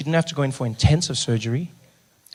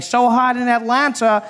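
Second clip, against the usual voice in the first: high-pitched mock live report; imitated on-the-scene reporter; TV news reporter; 7 semitones higher than usual. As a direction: announce it like local breaking news. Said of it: "They'd be standing there going".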